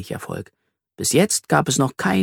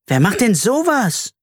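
indignant; 9 semitones higher than usual. A narrator's reading. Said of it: "rief Carla empört".

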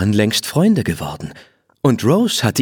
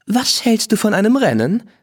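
much higher, lively challenging voice; a proud young mare daring a rival; spirited mare; 13 semitones higher than usual. Acted. Rose challenging Blackie to a race, high and bold.

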